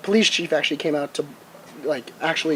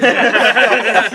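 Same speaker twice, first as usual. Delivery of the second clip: laughing; 6 semitones higher, 6 dB louder than usual. Real, not acted. Laughs at the apology.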